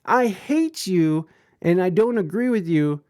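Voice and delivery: high pew-pew sounds, then playful taunt; mock word-shooting. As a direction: pretend to fire words like a toy gun. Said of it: "with your words saying".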